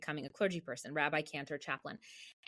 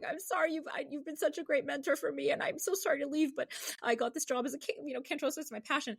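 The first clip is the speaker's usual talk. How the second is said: higher, tearful pleading apology; 9 semitones higher than usual. Retold or imitated. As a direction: imitate your own teary goodbye to the boss. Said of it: "I'm, like, crying".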